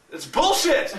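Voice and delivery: high-pitched mock outrage; indignant protest. Friend hears about sales tax, high indignant protest. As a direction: imitate an outraged objection, voice up high.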